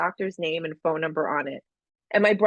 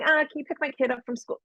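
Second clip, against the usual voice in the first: light, higher, offhand little request; casual mock ask; 7 semitones higher, faster than usual. Mocking a trivial favour request, breezy and offhand.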